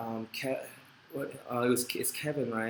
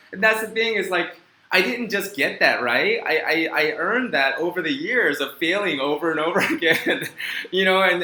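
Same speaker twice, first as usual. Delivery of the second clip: excited gushing; 5 semitones higher, 9 dB louder than usual. Real, not acted. Gushing about unconditional support.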